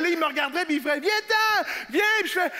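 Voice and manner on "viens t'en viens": urgent distant call; calling from afar. Acted. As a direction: holler across a distance to come over. Villager calls from afar.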